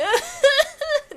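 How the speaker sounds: squealing high laugh. Real, not acted. Big laugh.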